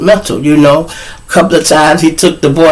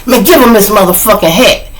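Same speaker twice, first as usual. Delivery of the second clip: higher, mock-angry demand; retold anger; 7 semitones higher, 3 dB louder than usual. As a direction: demand it back, fed up. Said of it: "I was so fucking mad".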